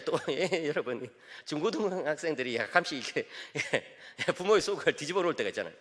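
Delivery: normal talking voice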